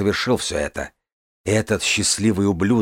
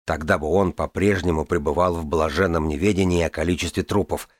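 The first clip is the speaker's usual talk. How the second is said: ordinary delivery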